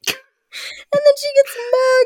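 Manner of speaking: very high, slow theatrical announcement; milk it like a melodrama; mock dramatic. Retelling the plot to a co-host, slow and mock dramatic.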